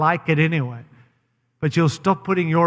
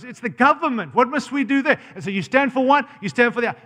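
ordinary talk

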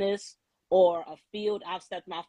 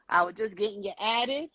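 usual speaking voice

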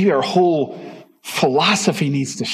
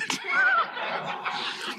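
high laugh; 9 dB quieter than usual. Real, not acted. Laugh after God's line.

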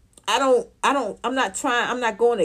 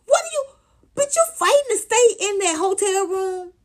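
sharply raised disbelieving yell; shocked disbelief; 12 semitones higher than usual. Retold or imitated. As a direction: squeal in disbelief at her. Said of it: "I was like".

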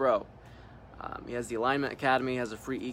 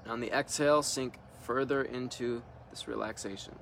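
calm meditation leader leaving room to breathe; guided meditation; 5 dB quieter than usual. Real, not acted. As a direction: guide them into relaxing, unhurried, one short instruction at a time. Leads a guided relaxation meditation.

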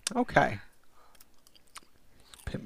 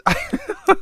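squealing laugh; 9 dB louder than usual. Real, not acted. Laughs at rock papers.

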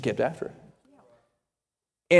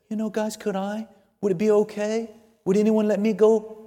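timid asking; 10 dB quieter than usual. Retold or imitated. Runt kitten timidly asks permission.

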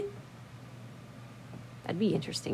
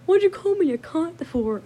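higher, louder imitation of an offended brother; mock indignant; 10 semitones higher, 5 dB louder than usual. Imitating brother objecting to being insulted, indignant. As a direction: protest like an affronted sibling.

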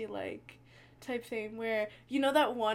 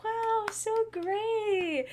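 high, very slow fake praise; mock enthusiasm; 10 semitones higher, 3 dB louder, much slower than usual. Adults drinking wine humoring the kids, slow high mock praise. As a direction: drag out the polite wow. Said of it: "they were like".

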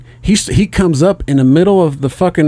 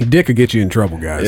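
normal talking voice

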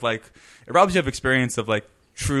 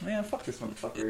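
muttered aside; 9 semitones higher, 14 dB quieter than usual. Retold or imitated. Randy mutters off camera.

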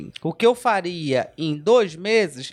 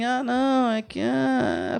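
weak, whiny hesitation; 4 semitones higher, much slower than usual. Retold or imitated. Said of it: "ela ainda falando meio assim".

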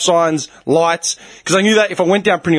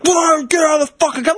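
high-pitched roar of a furious driver; retold road-rage shout; mock enraged shout; 11 semitones higher than usual. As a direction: imitate someone losing it, pitched up. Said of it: "he was losing it".

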